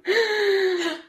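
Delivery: loud, high laughing complaint; cracking up, fed up with prayer talk; laughing exasperation. Real, not acted. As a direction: howl it through laughter. Laughing joke.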